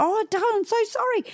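high, quick cooing apology; apologetic mum. Retold or imitated. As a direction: coo a sorry to a hurt child. Mum apologises to screaming child.

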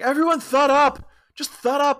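"thud up" lisped for shut up, high and loud. Acted. Parker Posey's character lisping at the crowd, shouting high. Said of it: "why are you talking like that".

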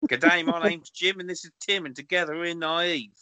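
amateur put-on Aussie accent; bad Australian accent. Co-host introducing Jim and Tim, shaky attempt at Australian accent. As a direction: try a jokey, clumsy Australian accent. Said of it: "I'm terrible at them".

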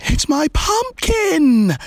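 high, drawn-out thrilled cry; gleeful child's shout; excited shout. A narrator's reading. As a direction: shout it high with delight, stretching the words. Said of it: "shouted Polly with excitement".

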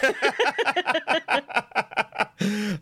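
high-pitched burst of laughter. Real, not acted. Laughing at own accent.

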